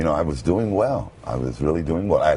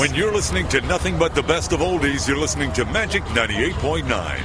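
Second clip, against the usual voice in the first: radio voice; 12 semitones higher than usual. Shown as a demonstration. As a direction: roll it out like an oldies DJ. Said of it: "Say something with that great radio voice".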